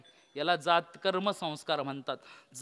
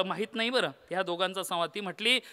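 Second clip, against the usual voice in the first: usual speaking voice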